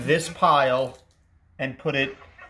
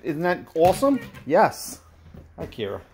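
ordinary delivery

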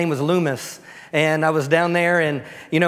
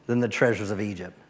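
normal talking voice

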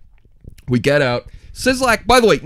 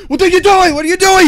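high, loud imitation of panicked screaming; 12 semitones higher, 5 dB louder than usual. Paul screams at the man dumping mice, high mock scream. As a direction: scream it in disbelief, pitched way up. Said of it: "He's screaming".

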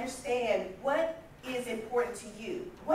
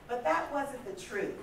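ordinary delivery

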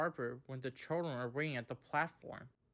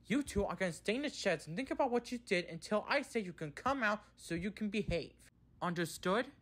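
higher-pitched, insistent telling-off; firm lecture; 6 semitones higher, 4 dB louder than usual. A narrator's reading. He sends the two engines to the sheds, firmly. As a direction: drive the punishment home firmly. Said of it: "he added firmly".

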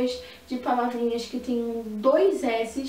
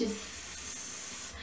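long whispered hiss Sss; snake hiss; 14 dB quieter than usual. Shown as a demonstration. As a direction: hiss like a snake, strong and airy. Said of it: "o barulho das duas juntas é bem forte". (chiado forte de serpente, sss)

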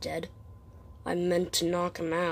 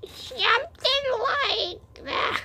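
slow, lightly put-on girl voice; a hint of a creepy girl voice; girl voice attempt; slower than usual. Shown as a demonstration. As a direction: try for a girl voice, slowly. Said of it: "that's the best I can make a girl voice".

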